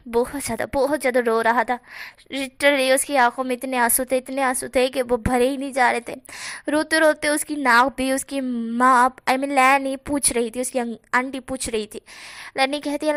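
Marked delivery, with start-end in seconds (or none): none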